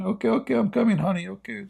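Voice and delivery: high, soft timid reply; meek husband. Retold or imitated. Husband answering his wife, high and meek. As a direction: give in like a henpecked husband.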